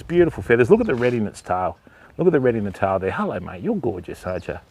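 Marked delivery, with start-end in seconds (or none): 3.1-4.6 soft, low murmur to a bird; tender hush. Real, not acted. Keeper softly murmurs to the young cockatoo. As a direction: gently soothe the bird.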